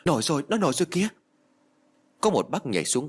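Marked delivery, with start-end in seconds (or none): 0.0-1.1 quick, high shout of discovery; acted cry, high and rushed; calling out. A narrator's reading. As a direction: alert the crowd urgently. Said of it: "ai đó liền hô lên".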